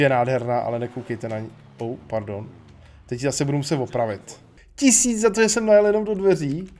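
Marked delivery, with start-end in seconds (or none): 4.7-6.7 high-pitched griping; indignant complaint. Real, not acted. Gamer grumbling about paying to fix a car door, indignant. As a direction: protest the bill in a raised, higher voice.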